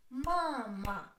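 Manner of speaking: "Mama" drawn out in two syllables. Shown as a demonstration. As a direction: split "Mama" into ma, ma for a learner. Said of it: "Two syllables".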